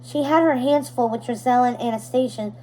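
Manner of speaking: usual speaking voice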